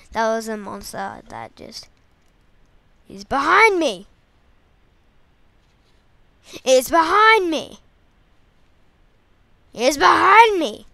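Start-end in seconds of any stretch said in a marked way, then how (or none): 3.1-10.9 louder and louder pretend fear; hammed-up fright, growing; mock panic. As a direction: build a fake panic, then yell. Pretending monster is behind.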